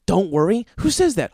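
high, loud indignant repeat; mocking outrage. Comedian throws the guy's words back, high and angry. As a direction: spit the phrase back in disbelief.

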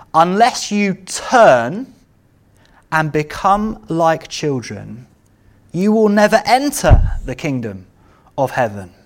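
slow, weighty, turn leaned on hard; stress on turn. Shown as a demonstration. Preacher quoting Jesus to the congregation, slow stressed. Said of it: "I want to emphasize the word turn".

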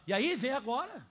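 put-on daring the boy to come at him; defiant big sister.

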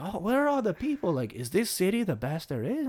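high-pitched mock French accent; Curie, French accent. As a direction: wonder aloud in a put-on French voice. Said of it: "this incredibly racist French accent".

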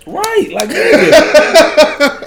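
high-pitched incredulous outburst; hammed-up astonishment, very high; mock disbelief. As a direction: squeal it in comic shock. Joking shock over British actors.

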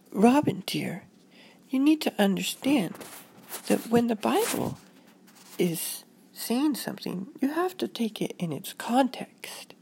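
tender, lilting mom voice; patient mother. Acted. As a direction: explain gently to a scared child. Voices mother comforting Robin.

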